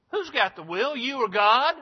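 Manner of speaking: preacher pressing the congregation on God's will, forceful; forceful preaching. Acted. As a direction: press the point home to the congregation. Preacher rises to full force.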